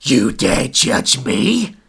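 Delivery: slow, menacing spirit voice; a hint of a haunting spirit; ghost voice. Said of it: "the rambling voice".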